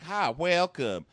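slow, high, mock Southern drawl; fake Southern accent. Contestant welcoming Rachel, exaggerated fake Southern accent. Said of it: "I don't even believe your accent".

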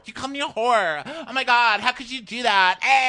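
very high, loud hammed-up offense; mock outraged shriek. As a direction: parody an offended girlfriend screeching. Acted lovers' quarrel escalating.

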